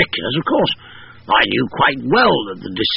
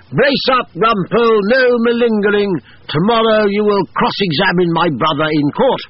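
brisk command; 9 semitones higher than usual. A narrator's reading. Colonel repeats order, insisting.

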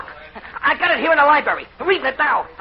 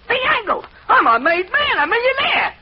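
elated; 10 semitones higher than usual. Acted. Discovers secret code, elated.

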